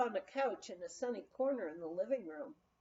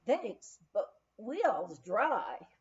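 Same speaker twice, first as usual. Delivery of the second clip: Texan drawl; slower than usual. Retold or imitated. Texan spokesman replies.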